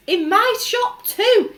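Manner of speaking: loud, high, slow yell. A narrator's reading. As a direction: yell it out, beside himself. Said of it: "he yelled".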